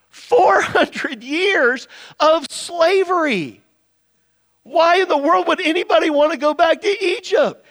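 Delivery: incredulous. Real, not acted. Incredulous at longing for slavery.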